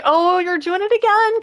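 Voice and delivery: ordinary talk, nothing marked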